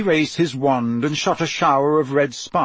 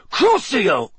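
loud, high cry falling away; attacking spell shouted high; shouted curse; 8 semitones higher, 5 dB louder than usual. A narrator's reading. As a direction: hurl the curse out forcefully. Krum casts a torture curse.

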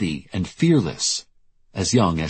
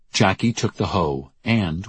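ordinary delivery, nothing marked

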